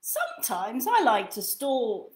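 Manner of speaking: gentle lively storybook read; reading to children. Acted. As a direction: read it like bedtime for a toddler. Reads second book aloud.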